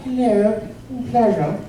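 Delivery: lightly voiced posh British greeting; a hint of the Queen being gracious; Queen of England. Comedian greeting the audience as the Queen, lightly posh. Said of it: "imagine, if you will, the Queen of England".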